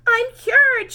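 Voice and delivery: high, loud triumphant cry; joyful shout. A narrator's reading. Camilla shouts happily that she is cured. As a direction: shout it with delight. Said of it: "she shouted".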